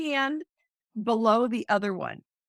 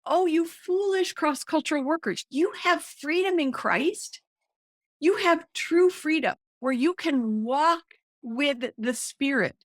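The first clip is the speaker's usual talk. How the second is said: exasperated Paul; 4 semitones higher than usual. Acted. Acting Paul scolding foolish cross-cultural workers, exasperated. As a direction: plead in mild exasperation, stretching the key words. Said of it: "you can hear Paul's exasperation".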